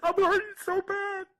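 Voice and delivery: sobbing the words out; crying out in pain. Real, not acted. Distraught father's farewell video.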